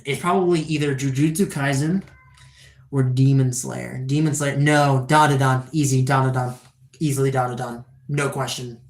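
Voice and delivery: ordinary talk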